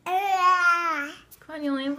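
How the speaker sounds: loud, high come on; loud coaxing. Real, not acted. Urging baby to keep eating.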